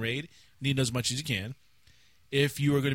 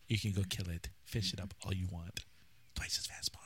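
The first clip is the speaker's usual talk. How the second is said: hushed secret whisper; 5 semitones lower, 11 dB quieter than usual. Pretend. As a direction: breathe it like a secret. Mock patch note, whispered.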